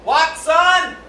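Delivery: high, loud hard-of-hearing reply. Acted. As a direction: call it out like you can't hear. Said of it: "hard of hearing inspectors go".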